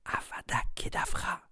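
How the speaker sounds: breathy hushed whisper of a forbidden spell; barely voiced, as if afraid to be overheard. A narrator's reading. Frightened boy whispers the killing curse to Harry. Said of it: "sa voix devint un murmure".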